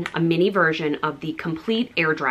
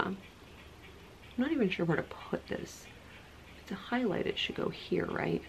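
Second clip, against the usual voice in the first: much softer, half-whispered musing; hushed; 14 dB quieter than usual. Real, not acted. Musing to herself about where the highlighter goes, very hushed. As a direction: murmur to yourself while placing it.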